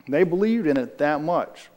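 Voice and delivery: ordinary talk, nothing marked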